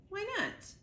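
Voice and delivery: put-on high, sharp young objection; protesting gosling. Gosling protests Roz's refusal, high and indignant. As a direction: push back like a hurt child.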